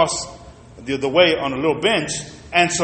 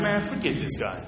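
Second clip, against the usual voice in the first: muttering to himself; 4 semitones higher, 10 dB quieter than usual. Retold or imitated. Muttering in his head.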